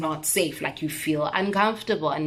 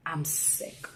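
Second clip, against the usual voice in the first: breathy, much softer whisper of disgust; sick whisper; 2 semitones lower, 11 dB quieter than usual. Real, not acted. Vlogger after retelling the threat, whispered disgust. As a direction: barely voice it, drained.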